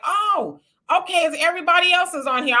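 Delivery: high, quick surprised oh; surprised realization. Retold or imitated. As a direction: caught off guard, pitch jumping up. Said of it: "I was like".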